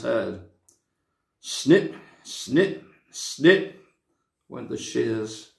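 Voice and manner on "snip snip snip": snip snip. A narrator's reading. Shears sound in story.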